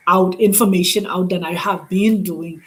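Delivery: ordinary talk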